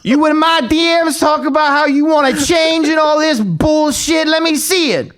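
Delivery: high-pitched imitation of a shouting coach; screaming coach. Coach who threw the pads down, screaming at his trainee. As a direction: yell it like a coach losing patience. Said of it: "he would just scream at me".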